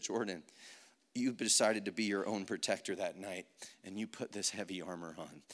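low, soft voice of God; a hushed, lightly voiced Lord; the Lord speaking. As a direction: speak quietly and low, as God gently confronting. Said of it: "the Lord speaks to me".

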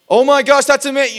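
high, very loud mock gushing; over-the-top yelling. Showing how not to talk to a friend on a tender subject, hammed-up yelling. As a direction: blast it out far too excitedly. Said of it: "that's not how you talk".

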